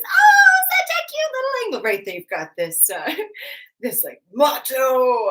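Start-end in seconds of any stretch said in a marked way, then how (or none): 0.0-1.8 mock squeal, very high; high-pitched cutesy voice. Teacher mimicking what macho angles won't say, high-pitched. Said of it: "clearly not my high-pitched voice". 4.3-5.3 hint of a macho guy, drawn out and loud; lower macho voice. Shown as a demonstration. Teacher showing how big obtuse guys talk, lightly voiced macho. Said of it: "lower voice, you know, clearly not".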